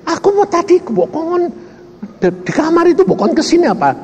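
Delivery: high, fast puzzled questioning; bewildered protest. Retold or imitated. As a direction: demand an answer, baffled. Papa questioning God, bewildered. (protes bingung bernada tinggi dan cepat)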